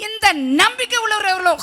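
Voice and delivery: loud, high call to the crowd; rousing preaching shout. Real, not acted. Preacher asking who shares this hope, rousing shout. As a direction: fire up the congregation with a shout.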